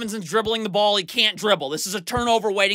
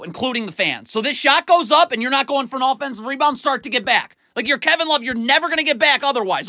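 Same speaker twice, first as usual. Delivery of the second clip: normal talking voice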